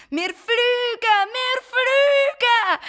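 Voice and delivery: long, high drawn-out cry of joy; joyful shout. Acted. Garnelli shouts with joy while flying, high and slow. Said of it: "brüllt vor Freude".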